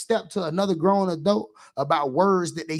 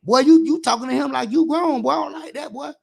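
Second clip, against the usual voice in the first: raised, higher scolding voice, louder at the start; scolding a kid; 6 semitones higher, 5 dB louder than usual. Retold or imitated. Coach scolding an eleven-year-old in the store, sharp and raised. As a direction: coach dressing down a rude boy. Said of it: "I step down on his ass".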